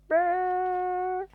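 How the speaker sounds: loud high shivering brrr. Acted. Cold weather shiver sound.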